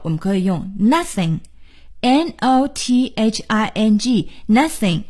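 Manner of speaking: ordinary talk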